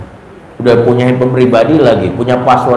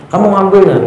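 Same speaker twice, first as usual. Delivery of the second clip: suara ibu bertanya; 8 semitones higher, faster than usual. Retold or imitated. Ibu bertanya pada anak.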